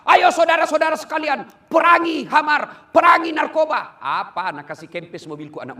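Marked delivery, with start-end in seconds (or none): 0.0-4.0 loud rallying call from the pulpit; fiery sermon cry; preaching shout. Retold or imitated. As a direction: call out to the crowd at full force. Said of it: "Saya ceramah".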